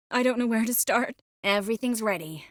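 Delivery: usual speaking voice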